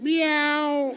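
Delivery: high mewing cat voice; dog says meow like a cat. Acted. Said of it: "say something in a foreign language".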